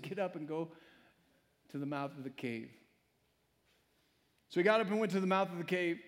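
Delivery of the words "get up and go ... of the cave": quiet, lower divine command; soft voice of God. Acted. God telling Elijah to go, quiet and low. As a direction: speak it softly as God. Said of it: "God said".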